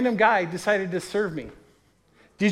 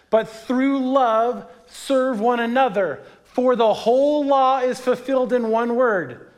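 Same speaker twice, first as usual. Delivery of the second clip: slow, raised reading of the passage; louder, measured Bible reading; scripture reading; 5 semitones higher, 4 dB louder, slower than usual. Acted. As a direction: read the verse with weight, slowing down. Reads Galatians passage aloud.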